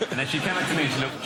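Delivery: ordinary talk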